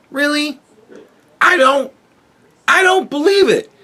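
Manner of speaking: high, sharp disbelieving questions; real incredulity. Real, not acted. Creator doubting the theory, high incredulous disbelief. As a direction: push the pitch up in disbelief.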